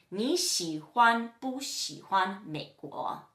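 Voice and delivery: slow, measured Mandarin example; slow model question. Shown as a demonstration. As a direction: separate the syllables for learners. Teacher models the first question pattern.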